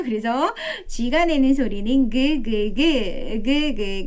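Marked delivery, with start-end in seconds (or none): 2.4-4.0 slow repeated 그 그 from the throat; G sound demo. Shown as a demonstration. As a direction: say 그 slowly again and again. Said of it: "이렇게 소리 내게 해주시고요".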